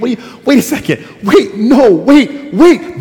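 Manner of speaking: high, loud, hurried wait wait; a scared man trying to stop the question; panicked interruption. Acted. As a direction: cut in frantically before the question lands. Adam panics, interrupts God.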